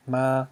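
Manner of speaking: short demo of high-tone Ma. Shown as a demonstration. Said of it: "pronounce with a high tone".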